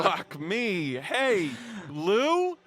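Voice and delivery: loud, rising high whine; hammed-up aggrieved kid; pained protest. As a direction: call him out in wounded disbelief. Player reacts as injured kid.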